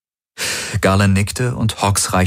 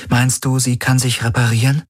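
put-on raised higher, gentle young woman; Kirai's voice; 6 semitones higher than usual. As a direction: speak as Kirai, caring for the wounded.

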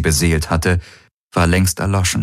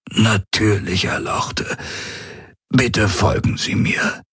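put-on elderly attendant; 3 semitones higher, slower than usual. A narrator's reading. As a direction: give in deferentially as an old servant. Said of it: "murmelte der greise Aur".

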